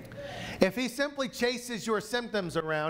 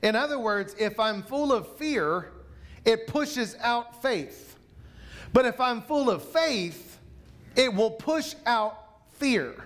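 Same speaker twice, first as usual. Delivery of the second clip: preaching at full force; 3 semitones higher than usual. Acted. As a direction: drive it home to the congregation, building.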